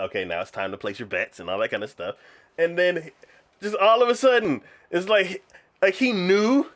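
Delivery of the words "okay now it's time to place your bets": low, brisk run-through; quick announcer patter. Retold or imitated. Event holder opening the betting, quick patter. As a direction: rattle it off in a lower voice. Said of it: "kind of quick".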